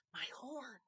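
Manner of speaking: hushed so the elf won't hear; whispered. A narrator's reading. Star whispering to Moon while hiding, hushed. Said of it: "whispered Star".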